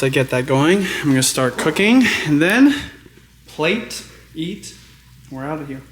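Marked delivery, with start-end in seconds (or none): none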